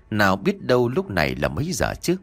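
usual speaking voice

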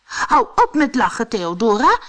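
high, loud reprimand; scolding. Acted. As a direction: tell off a giggler sharply. Hero scolds the giggling spider.

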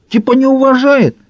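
very high, affronted question; indignant. A narrator's reading. Маркер suspects the caller lacks respect, indignant. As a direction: squawk in offence, pitch right up. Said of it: "возмутился маркер".

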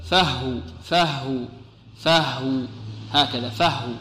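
فهو with the final ha held silent, slow; pausal form demo. Shown as a demonstration. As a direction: stop on فهو with a sukun on the ha. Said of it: "كيف نقف عليها؟".

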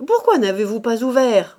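loud, sharp challenge; angry demand. A narrator's reading. The cook challenging Mouret, angry demand. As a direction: demand an answer loudly.

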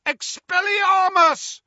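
high, loud, drawn-out incantation; long ringing wand cry; yelled spell. A narrator's reading. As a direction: yell the disarming spell. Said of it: "Harry yelled".

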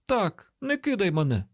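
high, panicked cry; frantic high-pitched plea; desperate pleading. A narrator's reading. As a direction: beg not to be abandoned. Captive begs not to be left.

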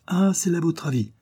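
high-pitched mocking question; lightly sneering, lifted tone. A narrator's reading. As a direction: feign surprise with open disrespect. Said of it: "d'un ton moqueur".

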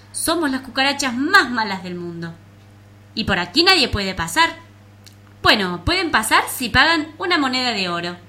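loud bullying bug voice; menacing cockroach voice. Acted.

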